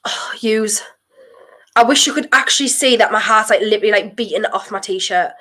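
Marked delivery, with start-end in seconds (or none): 0.0-0.8 hushed, breathy whisper; tense whisper. Real, not acted. Fan watching a tense attack whispers, tense. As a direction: breathe the name in worry.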